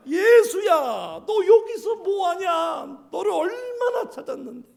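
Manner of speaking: put-on high, slow scolding shout at the lost boy; mother scolding her found son, half laughing; scolding parent. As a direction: yell at him like a frantic parent. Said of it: "야단칩니다".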